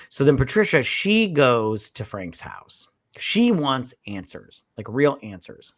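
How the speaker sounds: usual speaking voice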